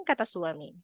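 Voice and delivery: ordinary talk, nothing marked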